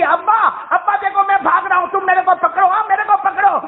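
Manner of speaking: put-on high, playful little boy calling his father; child's voice. Little Ismail teasing his father to catch him, high and playful. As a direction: sound like a seven-year-old begging to be chased. Young son playing with father.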